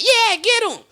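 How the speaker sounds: high, loud cheer from the crowd; mock crowd girl cheering. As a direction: egg on the heckler, squeaky high. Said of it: "a ratchet girl just in the crowd go like".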